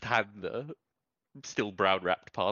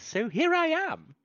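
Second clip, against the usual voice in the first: high and slow, sing it out; bright singsong; 7 dB louder, slower than usual. Acted. Bright rising end to the clerk.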